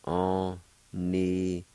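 Onigolia drawn out slowly; slow model word. Shown as a demonstration. Teacher slowly modelling the word for learners.

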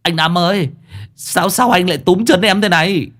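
high-pitched, stammering complaint; whining yelp at the boss; yelping protest. Acted. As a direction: squawk in startled dismay. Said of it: "cũng lao lên oai oái".

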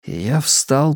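ordinary delivery

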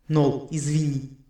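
slow, echoing thought; telepathic apology. A narrator's reading. Investigator to his mind-reading partner, echoing apology. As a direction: apologise slowly inside her head, with echo. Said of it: "подавился мыслью Эрин".

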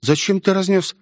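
put-on high-pitched, astonished girl; rising whoas into an amazed question; surprised. A narrator's reading. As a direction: voice a girl taken aback. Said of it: "удивлённо произнесла Мелвин".

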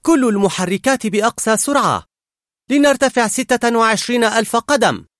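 high, loud captain's orders; shouted commands. A narrator's reading. Girl playing pilot orders her engines to full speed, loud commanding shout. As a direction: call out orders at full volume. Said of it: "وتعطي الأوامر".

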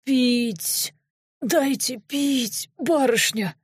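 slow, strained plea for water; drawn-out croaky moan; weak, pained begging. A narrator's reading. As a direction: beg as an injured, parched man. Wounded prisoner begs for water.

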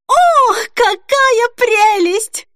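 very high, loud, drawn-out squeal; deafening squeal. A narrator's reading. Denise delighted by yet another wedding dress, squealing. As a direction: squeal with delight, stretching every word. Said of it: "оглушительно заверещала Денис".

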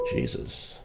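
usual speaking voice

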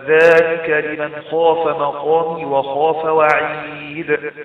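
slow chant, وعيد ending on a bounced د; qalqalah recitation. Shown as a demonstration. Teacher giving a verse example, slowly chanted, qalqalah on وعيد. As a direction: draw out وعيد and bounce its final د. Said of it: "فيحدث قلقلة عند القراءة".

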